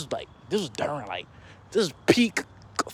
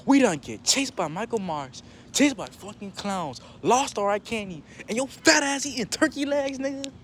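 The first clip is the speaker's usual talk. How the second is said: slow list climbing to high complaint; exasperated rant; 8 semitones higher than usual. Retold or imitated. Cousin to driver who left with the car, rising exasperated rant. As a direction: act out indignation, building higher line by line.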